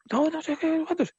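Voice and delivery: high-pitched mock agitated man; agitated stranger. Retold threat from aggressive stranger. (imitando a un desconocido alterado, muy agudo)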